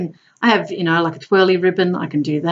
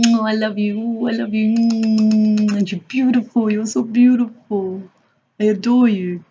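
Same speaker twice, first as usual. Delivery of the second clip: tender, slow, loving coo; loving clown voice; 3 semitones higher than usual. Retold or imitated. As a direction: pour loving energy into each word. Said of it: "I was speaking like that".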